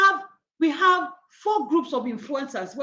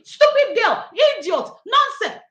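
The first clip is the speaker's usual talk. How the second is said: high, loud imitated child insults; imitated child cursing; 9 semitones higher, 5 dB louder than usual. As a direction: mimic a little girl cursing her playmates.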